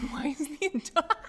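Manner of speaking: high, loud, cracking up while reading; laughing. Real, not acted. Streamer reading a teasing chat message, laughing. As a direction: burst out laughing mid-question.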